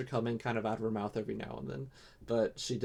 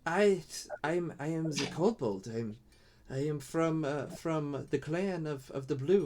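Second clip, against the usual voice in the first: lightly higher, hesitant creature voice; timid kobold; 5 semitones higher than usual. Acted. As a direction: stammer shyly like a small creature.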